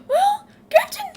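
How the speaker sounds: loud, very high falsetto call; put-on high, loud servant; startled servant voice. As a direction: pipe up as a squeaky attendant. Reads Phil's line in voice.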